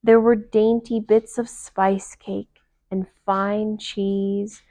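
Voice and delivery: ordinary delivery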